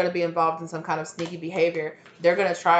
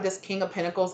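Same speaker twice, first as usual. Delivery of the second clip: ordinary talk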